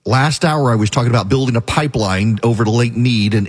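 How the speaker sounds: normal talking voice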